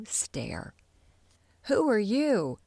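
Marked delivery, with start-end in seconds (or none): 1.7-2.6 sharp, louder demand; a raised, pitched-up demand. A narrator's reading. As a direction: challenge the strangers head-on. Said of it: "Faker demanded".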